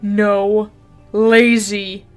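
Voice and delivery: ordinary talk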